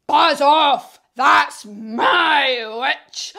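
loud, drawn-out possessive shout; fierce beast. Acted. Beast warns dragon off the witch, fierce shout.

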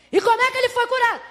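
high, quick demanding question; interrogating. Acted. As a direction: press the parents for an answer. Pharisees questioning the parents.

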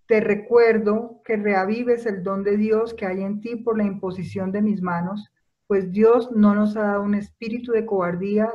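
ordinary delivery

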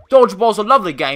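high, loud imitation British accent; mock British accent. Imitating Corden's team sipping tea, mock British accent. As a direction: parody a genteel British player. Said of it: "they're all from Britain".

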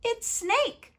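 very high little-creature voice; chirpy mouse. Acted. Mouse names who is coming, high and chirpy. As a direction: pipe it up high.

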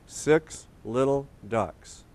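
slow, word-by-word reading; read-along. Shown as a demonstration. Host reading together with kids, slow and word by word. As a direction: read slowly, spacing each word for children to follow. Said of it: "Let's read it together".

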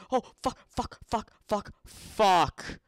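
high, loud panicked swearing; real panic yelled at the screen; frantic high shouts of fuck; panicked cursing. Real, not acted. Panics as the game mob attacks.

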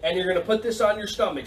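usual speaking voice

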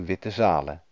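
ordinary delivery, nothing marked

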